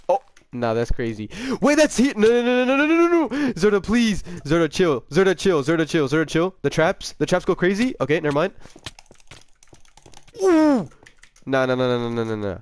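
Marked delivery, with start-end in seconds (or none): 1.6-4.2 high, frantic string of protests; panicked. Real, not acted. Player under attack from Zerda, panicked. 10.4-10.9 high falling whoop; sudden yelp of alarm; startled "ooh". Real, not acted. Player fighting Zerda in game, startled whoop.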